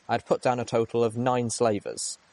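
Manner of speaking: plain reading voice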